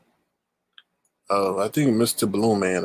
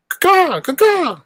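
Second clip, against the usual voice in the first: eagle call; 5 dB louder, slower than usual. Pretend. Eagle cry again.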